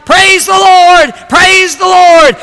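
very high, loud, drawn-out shouts; elated shouting. Retold or imitated. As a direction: shout praise at finding the groceries. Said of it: "she started shouting".

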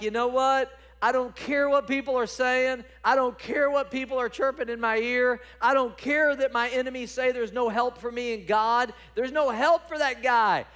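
loud, high, insistent preaching shout; defiant outburst. Acted. Preacher as David dismissing his enemies' talk, loud defiance. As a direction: throw it back at the doubters at full force.

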